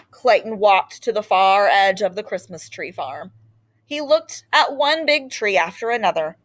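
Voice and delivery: usual speaking voice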